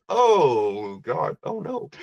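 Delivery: gasped, high-pitched shock that drops to near silence; dawning horror. Retold or imitated. Guest recognizing the ghost, horrified and breathless. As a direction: realize the ghost is real and lose your breath.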